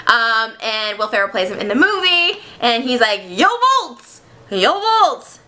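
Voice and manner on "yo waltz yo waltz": put-on high German-accented shout-out; mock German accent. Helmeted playwright greeting them, mock German accent. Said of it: "that's my German accent for this".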